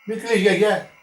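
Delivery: high, loud pleading call; calling to grandma. Retold or imitated. Boy calling from the window to comfort grandmother, loud plea. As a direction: beg her to stop crying. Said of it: "Ξανά λέω".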